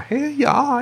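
southern drawl. Retold or imitated. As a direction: drawl "y'all" in a Southern accent and crack up on it. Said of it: "recognizes Lucas and his southern drawl".

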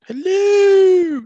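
very high, loud falsetto hello; Mrs. Doubtfire hello. Retold or imitated. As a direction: shriek hello like a prim old nanny. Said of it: "him going off the wall".

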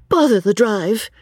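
put-on girl's high-pitched exclamation; exasperated high outburst; exclaiming. A narrator's reading. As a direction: burst out in high, girlish vexation. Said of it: "exclaimed the girl".

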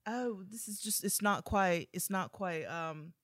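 hesitant, polite British put-down; imitating British judge. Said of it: "She goes".

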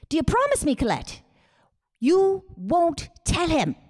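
high, pressing mother pleading in secret; mother's voice. Retold or imitated. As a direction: sound like a worried mom swearing you to silence. Daughter voices her mother's plea.

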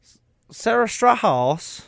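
"Strahaus" said slow and high, stretched out carefully; high, slow, hopeful try at "Strahaus"; careful pronunciation attempt. Shown as a demonstration. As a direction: sound out "Sarah Strahaus" slowly, testing it. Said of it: "Is that good?".

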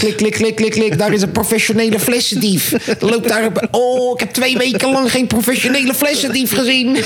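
high-pitched excited thief-spotter impression; Boevenspotter. Vlogger reporting a bottle thief, high excited impression. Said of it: "Boevenspotten van je eigen fles".

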